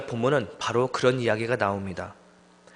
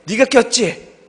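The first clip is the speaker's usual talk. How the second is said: louder, higher, slow mock accusation; slow accusing question; 9 semitones higher, 8 dB louder, much slower than usual. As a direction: drag out the accusation at the other friend. Friend accuses another friend.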